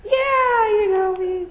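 very high, squeaky flustered answer; flustered yeah. Retold or imitated. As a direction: caught out, voice jumps up. Embarrassed reply after laugh.

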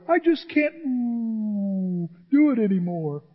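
hammed-up sulky complaint; whiny churchgoer. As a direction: imitate a self-pitying member. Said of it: "You're over there going".

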